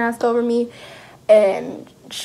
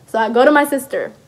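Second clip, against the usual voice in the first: ordinary talk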